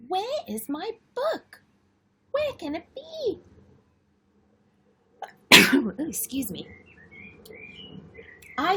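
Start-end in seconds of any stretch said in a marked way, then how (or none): 0.1-3.4 put-on high excited little girl voice, pleading; little sister Lola. Lola begging Charlie for her favourite book, high and pleading. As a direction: plead like an eager small girl. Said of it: "So Lola says".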